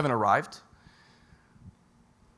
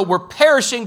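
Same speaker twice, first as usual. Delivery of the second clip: raised, louder panicked cry; mock panic; 6 semitones higher, 9 dB louder than usual. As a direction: play the frightened disciples shouting. Disciples panicking in the storm.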